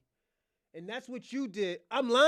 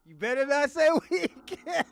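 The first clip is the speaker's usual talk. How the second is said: laughing warning; 6 semitones higher, 10 dB louder than usual. Real, not acted. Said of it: "repeated warning, laughing".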